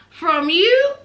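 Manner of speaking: rising incredulous question; mock disbelief. Host mocking Ty's claim, incredulous rise. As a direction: scoff at the idea, rising.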